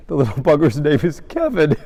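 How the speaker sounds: high, loud delivery about to crack up; laughing punchline. Real, not acted. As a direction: blurt the punchline through laughter.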